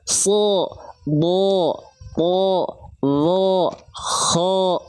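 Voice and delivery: "So. Bo. Po. Lo. Ho." each held, heavy, O-like; thick letters, unpouted. Shown as a demonstration. Teacher drills other thick letters, heavy and unpouted. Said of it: "Begitupun pada huruf yang lain".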